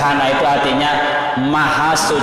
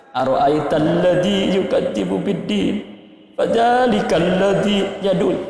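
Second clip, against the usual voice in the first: higher, slow tearful chant; touched reader reciting about orphans, higher and tearful; moved recitation; 6 semitones higher, slower than usual. Shown as a demonstration. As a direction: recite as if close to tears. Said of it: "pasti bisa menangis".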